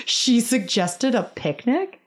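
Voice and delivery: mock laugh into a slow, high question; imitated laughing child. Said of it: "starts laughing and going".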